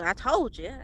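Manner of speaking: ordinary talk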